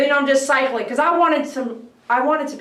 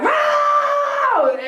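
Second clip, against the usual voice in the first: high shouted wow, much higher; 13 semitones higher than usual. Retold or imitated. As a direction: shout it like a crowd at a concert. House sounding like rock concert.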